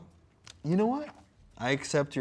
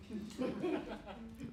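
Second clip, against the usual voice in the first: breathy laugh; 12 semitones higher, 11 dB quieter than usual. Real, not acted. Laughing at metaphor.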